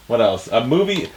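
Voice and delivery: ordinary delivery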